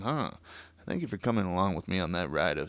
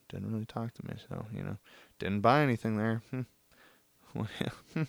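ordinary talk, nothing marked

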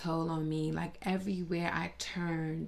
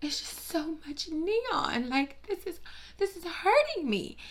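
comic suffering over too much neon; mock anguish; 10 semitones higher than usual. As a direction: moan it like neon physically hurts. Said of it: "And I'm like".

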